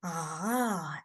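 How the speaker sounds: long "Ah" dipping low, then rising; drawn-out "Ah" that falls and comes back up; third tone. Shown as a demonstration. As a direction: let "Ah" sag down and climb again. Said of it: "it's falling down and rising up".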